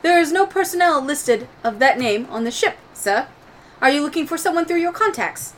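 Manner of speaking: high, formal droid voice; Proto. Acted. Game master's droid answering a status request, high and formal.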